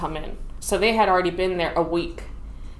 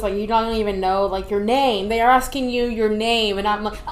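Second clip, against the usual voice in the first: usual speaking voice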